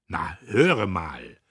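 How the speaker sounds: indignant brother. A narrator's reading. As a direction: drag it out in outrage. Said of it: "Diederich empörte sich".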